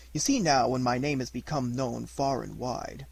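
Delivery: plain reading voice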